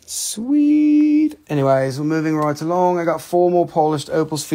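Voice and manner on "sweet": very high drawn-out squeal; mock delight. Seller cheers the imagined skull carving, high mock delight. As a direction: stretch it out way up high.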